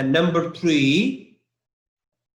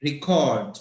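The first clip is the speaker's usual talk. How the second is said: "Record" as a verb, stressed on the second syllable CORD. Shown as a demonstration. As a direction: say "Record" as re-CORD, falling at the end. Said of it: "The word is a verb".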